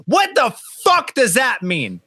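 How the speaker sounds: high-pitched, drawn-out disbelief; slow, incredulous outburst; exasperated. Acted. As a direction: demand an explanation in exasperation. Exasperated reaction to vague client request.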